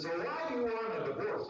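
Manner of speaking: high-pitched exasperated lawyer. Retold or imitated. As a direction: imitate a man at his wits' end. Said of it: "the man is really exasperated".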